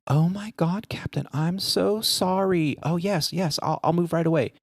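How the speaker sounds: high-pitched, drawn-out contrition; gushing mock apology. Passenger gives in to the captain, hammed-up apologetic gush. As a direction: imitate someone suddenly eager to obey.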